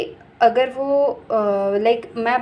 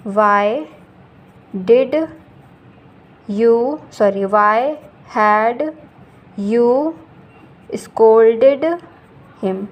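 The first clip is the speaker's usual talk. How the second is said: slow dictation; 6 dB louder, much slower than usual. Shown as a demonstration. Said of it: "ये हो जाएगा".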